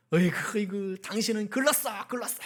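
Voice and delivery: hammed-up disgusted groan; mock despairing scorn. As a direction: act out giving up on a husband. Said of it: "막".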